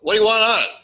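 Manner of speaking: loud, high cook calling across the counter; cook asking. Retold or imitated. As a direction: bark the question over the grill noise. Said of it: "the cook asks".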